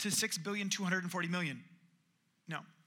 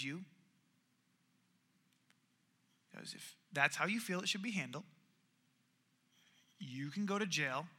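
grave quiet judgement; 5 semitones lower, 9 dB quieter than usual. Retold or imitated. King pronouncing judgement.

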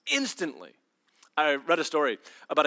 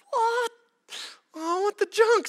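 much higher whiny wail; mock anguish; 4 dB louder, slower than usual. Driver finding more tickets, high comic wail.

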